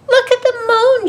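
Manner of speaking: high, loud delighted exclamation; thrilled storybook mouse; overjoyed cry. A narrator's reading. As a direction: cry out in joy as a little mouse. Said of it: "she cried".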